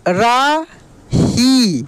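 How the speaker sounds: रा and ही held long with a pause between; drawn-out syllables. Shown as a demonstration. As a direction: sound out रा ही slowly in two beats. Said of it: "खींच कर किया जाता है". (रा ही, खींच कर अलग-अलग)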